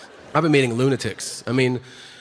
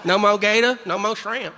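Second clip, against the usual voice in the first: higher-pitched, hammed-up Cajun accent; mock Cajun; 5 semitones higher than usual. Mocking Cajuns in their accent.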